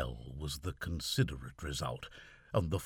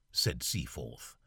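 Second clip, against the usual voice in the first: ordinary delivery, nothing marked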